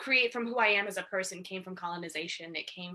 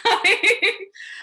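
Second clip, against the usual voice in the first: loud high laugh breaking the sentence; burst of laughter; 14 semitones higher, 13 dB louder than usual. Real, not acted. [name] laughing that her journey would take too long, loud laugh.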